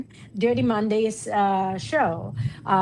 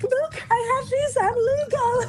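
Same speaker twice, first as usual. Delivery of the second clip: very high, squeaky mock plea; mock panicked pleading. Expat showing her visa, squeaky mock-panicked pleading. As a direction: plead your innocence in a comically high voice. Acts pleading with officials about papers.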